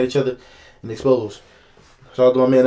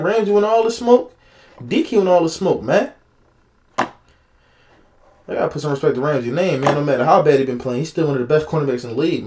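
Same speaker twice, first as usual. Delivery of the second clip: normal talking voice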